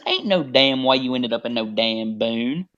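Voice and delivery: incredulous country twang, long vowels; Southern accent. Retold or imitated. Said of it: "I said".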